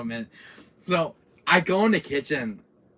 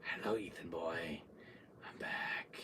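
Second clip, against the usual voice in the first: low, hushed villain murmur; very soft creepy menace; taunting; 11 semitones lower, 16 dB quieter than usual. Retold or imitated. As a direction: whisper it low, toying with your prey. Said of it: "he's just taunting me".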